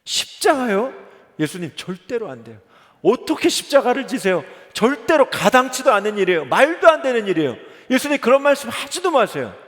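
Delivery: loud, high, agitated protest; Peter protesting. Acted. Peter protesting Jesus' talk of the cross, loud and high. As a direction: cry out against the cross in disbelief. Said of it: "한 번 더 대답을 해요".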